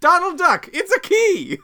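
high, loud excited call; excited shout. Pretend. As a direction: call it out big. Shouting at Donald.

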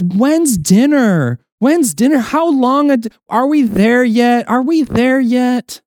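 put-on high, drawn-out nagging child voice; child pestering a parent about dinner, whiny; whiny impatient child. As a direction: nag like a kid in the back seat. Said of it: "the child that says".

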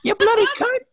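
high, loud mock British insult; British accent. Friend hurling a British insult in play, mock British accent. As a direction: fling a cartoon British curse. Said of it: "He said it in an accent".

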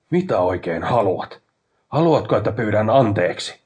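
loud, irritated snap, rising in force; snapping. A narrator's reading. Exasperated man challenges his brother in a quarrel, snapping.

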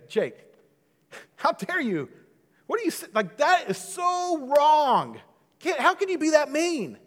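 high, loud indignant bystander voice; scolding bystander. Pretend. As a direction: play the shocked onlooker scolding Jake. Listener scolding the preacher, voiced.

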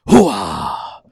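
loud, raspy Pacino shout; Al Pacino impression. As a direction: bark it like Pacino. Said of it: "It's like".

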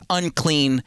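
normal talking voice

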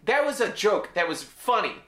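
normal talking voice

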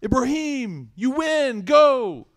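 high, loud mock cheer. Readers cheering Ibrahim on, high and loud mock cheer. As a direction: hype him up like a careless crowd. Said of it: "everyone's like".

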